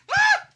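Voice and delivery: very high, loud sneeze scream; yelled sneeze. Acted. Sneezing out loud in public, high explosive yell. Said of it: "where you just yell".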